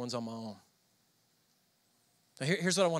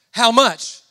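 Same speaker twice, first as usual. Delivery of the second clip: sudden much louder retold exchange; acted loud outburst; 11 dB louder, slower than usual. Husband and wife on giving the savings, acted loud outburst. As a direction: belt out each short line.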